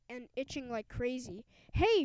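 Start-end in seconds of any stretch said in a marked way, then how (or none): 1.7-2.0 high, loud call; coach shout. A narrator's reading. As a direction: coach yelling hey. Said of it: "shouted the coach".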